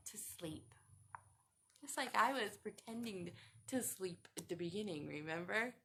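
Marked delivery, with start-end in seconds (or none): none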